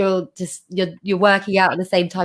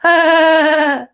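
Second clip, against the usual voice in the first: high, loud sustained "haaa"; demonstrated laugh; 8 semitones higher, 7 dB louder than usual. Shown as a demonstration. Laughter coach showing how to lose inhibitions, demonstrated laugh. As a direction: let out a loud, high open laugh sound. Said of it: "just being able to go".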